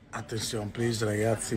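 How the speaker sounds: softly calling the group to listen; hushed announcement; quiet call for attention. Acted.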